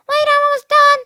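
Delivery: little sister chattering from the bathroom; little girl voice. Acted. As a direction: play a carefree little girl. Brianna voiced.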